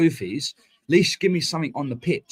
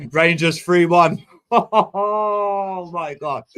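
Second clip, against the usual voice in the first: slow, loud, laughing through the score; laughing disbelief; 5 semitones higher, 6 dB louder, much slower than usual. Real, not acted. Friend reads a score aloud, laughing in disbelief.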